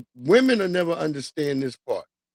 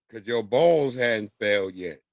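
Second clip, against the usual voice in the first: ordinary talk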